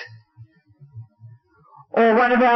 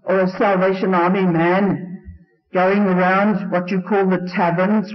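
ordinary talk

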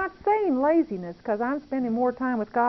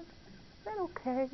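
slow, softer timid repeat; hushed plea; 6 semitones higher, 13 dB quieter, slower than usual. Retold or imitated. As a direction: barely ask it again, slowly. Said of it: "He said".

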